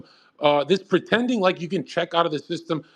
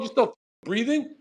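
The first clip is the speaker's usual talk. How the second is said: normal talking voice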